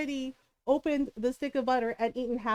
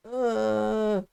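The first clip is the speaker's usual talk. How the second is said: long, lower held groan; imitated strained groan; 4 semitones lower than usual. Daughter having trouble pooping, straining groan. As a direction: groan like a constipated kid straining.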